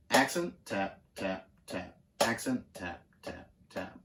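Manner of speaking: "Accent" at talking pitch, each "tap" dropped low and very soft; rhythmic drum count. Shown as a demonstration. Drum teacher demonstrates stopping the hand, rhythmic count. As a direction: count in even beats, letting every "tap" fall away quietly.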